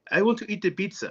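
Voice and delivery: light imitation of an Arabic speaker saying bizza; playful Arabic accent; mock Arabic accent. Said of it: "everything turns to a B".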